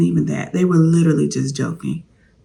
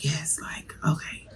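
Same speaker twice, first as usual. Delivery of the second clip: hushed, satisfied yes; whispered delight; 11 dB quieter, slower than usual. Retold or imitated. Pleased with the catfish, whispering a happy yes. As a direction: savour it under your breath. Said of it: "I was just like".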